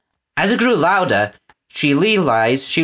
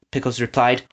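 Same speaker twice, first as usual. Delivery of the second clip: plain reading voice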